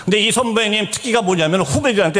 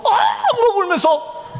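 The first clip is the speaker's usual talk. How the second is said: high, drawn-out mock wail; imitated bawling, slow and high; retold wailing; 14 semitones higher, much slower than usual.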